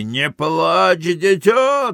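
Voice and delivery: high, rising crowd call; cheering cry. Acted. Voice from the carousel to a racer, high cheering cry.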